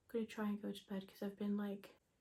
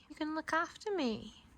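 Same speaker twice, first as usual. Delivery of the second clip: higher cooing pet voice; talking to a pet; 8 semitones higher than usual. Real, not acted. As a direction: coo at the dog. Talking to dog Stormy.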